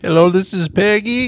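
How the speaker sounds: high, loud, chirpy phone greeting; mock a sugary receptionist answering; customer service voice. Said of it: "that's not your customer service voice?".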